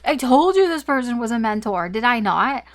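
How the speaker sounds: high, loud burst of triumph; real excitement. Real, not acted. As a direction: shout it out, thrilled you were right. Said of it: "I got really excited".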